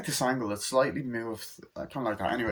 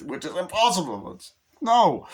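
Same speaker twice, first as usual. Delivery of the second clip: laughing through the words, higher; 7 semitones higher, 5 dB louder than usual. Real, not acted. Laughs at own hopeless task.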